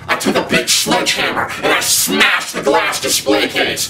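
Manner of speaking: normal talking voice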